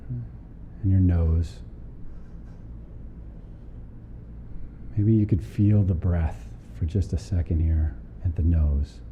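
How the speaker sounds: calm body-scan guidance with long pauses; guided meditation. Real, not acted. Teacher guiding a group through a body scan, calm meditation voice. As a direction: lead them unhurriedly down through the body, leaving space between phrases.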